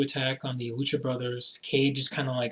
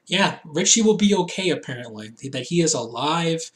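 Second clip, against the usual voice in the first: usual speaking voice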